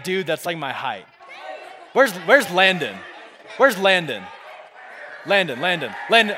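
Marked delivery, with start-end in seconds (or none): 1.9-6.4 calling out. Real, not acted. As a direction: call out for someone in the audience. Calling someone up from the crowd.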